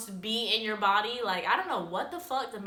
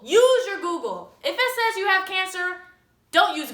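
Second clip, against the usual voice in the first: loud, higher-pitched exasperated rant; loud self-scolding; 6 semitones higher, 6 dB louder than usual. Real, not acted. Vlogger telling viewers to learn from her mistake, loud scolding. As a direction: scold yourself and the viewers at full volume.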